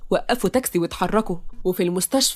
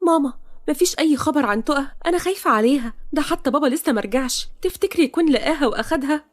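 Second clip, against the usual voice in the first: put-on higher-pitched young woman fretting to her mother; worried daughter; 6 semitones higher than usual. Sama worrying to her mother about missing Toqa, anxious.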